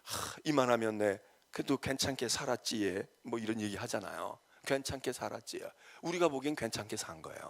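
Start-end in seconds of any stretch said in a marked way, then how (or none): 0.0-3.1 soft, low imitation of Gyeongsang speech; Gyeongsang dialect. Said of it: "경상도 말로 뭐라 그러잖아요". 4.7-5.6 put-on quiet Gyeongsang-accented quote; Gyeongsang dialect. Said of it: "경상도 말로 뭐라 그러잖아요".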